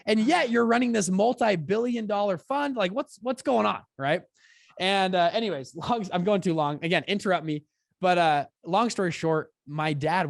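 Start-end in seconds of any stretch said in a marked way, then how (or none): 0.1-3.8 higher and louder, exasperated; mock outrage. Son confronting dad about the fund, exasperated. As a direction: press him for an explanation.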